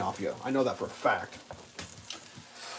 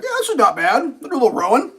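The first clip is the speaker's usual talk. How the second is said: mock cheerful self; 9 semitones higher, 4 dB louder than usual. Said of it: "be like".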